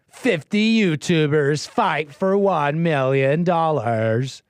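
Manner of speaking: slow, stretched-out title read; mock title announcing. Commentator reading out YouTube titles, slow mock announcing. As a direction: draw every word out with mock grandeur. Reads out hype video titles.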